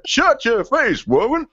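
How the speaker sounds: put-on high, drawn-out comic accent; hammed-up cartoon accent, stretched and high; put-on South Park accent. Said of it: "I can't do the accent, but you actually do quite well".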